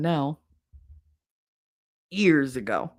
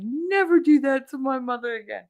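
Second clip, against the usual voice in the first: high, indignant warning, imitated; retold scolding, raised pitch; telling off; 7 semitones higher than usual. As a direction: lay down the law in mock outrage. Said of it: "He told his father off".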